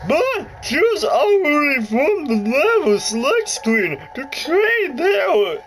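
high put-on voice of the lady game guide; announcer-like high lady voice, slow and bright. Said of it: "I hate talking in that voice".

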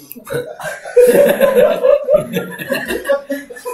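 high, helpless laughing fit; long squealing laugh; laughing hard. Real, not acted. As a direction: crack up in high-pitched laughter. Cracks up at own demonstration.

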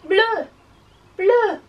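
high, loud French word; loud, punchy classroom chant; really loud. Shown as a demonstration. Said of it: "say it really loudly".

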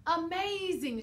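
high, loud cheering shout. A narrator's reading. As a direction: shout it out in delight. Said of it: "shouted the elves".

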